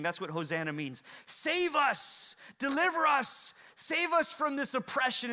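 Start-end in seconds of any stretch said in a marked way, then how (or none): 1.3-5.3 high, urgent plea to be saved; crowd crying out. Acted. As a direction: call out loudly for deliverance. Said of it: "they were crying out".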